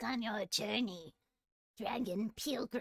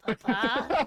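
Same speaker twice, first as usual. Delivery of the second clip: laughing; 2 semitones higher, 9 dB louder than usual. Real, not acted. Laughing at being adopted.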